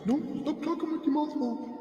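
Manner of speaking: high put-on auntie voice; mock scolding aunt. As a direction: nag like a bossy aunt at dinner. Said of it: "my aunties always telling me".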